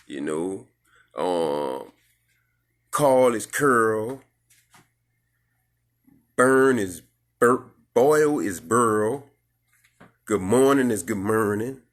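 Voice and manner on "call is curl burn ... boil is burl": "call" said like "curl", "boil" like "burl", slow and louder; New Orleans slur. Shown as a demonstration. Host showing how New Orleans folks slur words, slowly. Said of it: "we talk with a slur".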